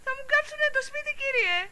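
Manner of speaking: very high, rushed plea; high-pitched desperate appeal; distressed pleading. Acted. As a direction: plead in panic. Pleads for the house.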